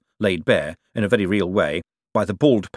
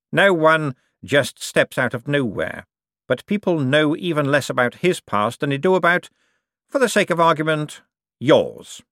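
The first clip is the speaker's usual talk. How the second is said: higher, measured, pointed voice; veiled-threat Cosmo; 7 semitones higher, 3 dB louder than usual. Acted. Cosmo hints at Bent's unknown past, smoothly menacing. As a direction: dangle the threat, then land hard on the last word.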